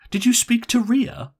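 high, loud and urgent; impatient. A narrator's reading. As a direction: press impatiently for an answer in a high voice. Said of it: "she asked impatiently".

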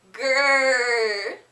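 loud playful bear growl. Pretend. Answering for the kids at home, loud playful growl. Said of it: "A little bit louder".

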